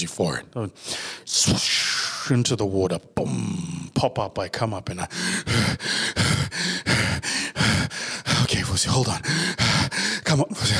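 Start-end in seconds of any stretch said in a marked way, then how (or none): 5.1-8.4 a long breathless exhale after surfacing; heavy gasping sigh. Acted. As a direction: blow out the air as if winded.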